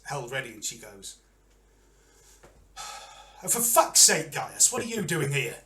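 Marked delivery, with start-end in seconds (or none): 2.8-3.4 soft, weary exhale; exasperated sigh. Acted. Champion exasperated at seeing friend. 3.4-5.6 louder, higher first words, then easing; exasperated. Acted. Champion exasperated at seeing friend.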